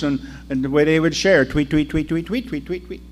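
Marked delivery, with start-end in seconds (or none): 1.4-3.0 light tweeting for gossiping women; chattering birds. Retold or imitated. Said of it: "Can you hear 'em talking?".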